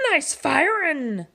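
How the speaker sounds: slow, drawn-out pirate praise; pirate approval. Acted. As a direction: savour the compliment like a captain. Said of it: "said Pete".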